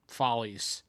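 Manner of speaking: ordinary talk, nothing marked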